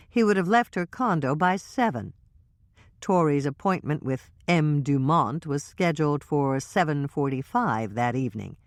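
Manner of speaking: ordinary delivery, nothing marked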